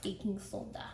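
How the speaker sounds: soft Minnesota accent with rounded O. Shown as a demonstration. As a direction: say it like you're from Minnesota. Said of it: "say it like you're from Minnesota".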